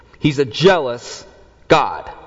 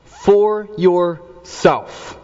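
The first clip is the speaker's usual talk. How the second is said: "for yourself" drawn out very slowly, word by word; emphatic; much slower than usual. Real, not acted. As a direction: set "for yourself" apart, each word stretched and weighted. Preacher singles out key phrase.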